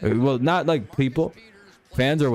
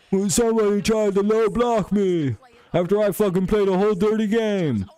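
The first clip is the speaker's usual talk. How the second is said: high, whiny put-on complaint; mock a defenseman crying foul; hammed-up high whine; mock whiny defender; 11 semitones higher than usual. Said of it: "these defensemen cry".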